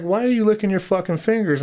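high, incredulous mock yell; retold yell. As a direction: re-enact yelling at him, pitch way up. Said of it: "So I'm yelling at him".

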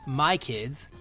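ordinary talk, nothing marked

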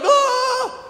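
high mock shriek of panic; a hammed-up terrified squeal; panicked scream. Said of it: "the people are like".

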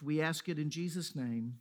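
usual speaking voice

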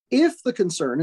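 "If" punched at the start; stress on IF. Real, not acted. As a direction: open firmly on an emphatic "If". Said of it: "again, I emphasize if".

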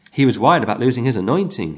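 usual speaking voice

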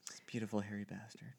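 hushed unvoiced whisper. Real, not acted. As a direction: breathe it as a secret. Whispered aside.

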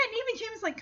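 normal talking voice

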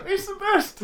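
high, strained forced praise; fake enthusiasm. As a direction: grit your teeth and insist it's great. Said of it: "through gritted teeth".

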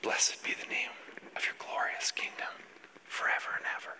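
fully whispered, under the breath; whispered prayer. Retold or imitated. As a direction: whisper it reverently to yourself, in short phrases. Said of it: "you would whisper under your breath".

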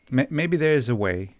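ordinary talk, nothing marked

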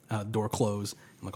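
ordinary delivery

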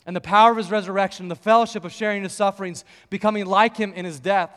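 loud, driving preaching; preacher mode. Retold or imitated. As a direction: preach it with full conviction. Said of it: "in full preacher mode now".

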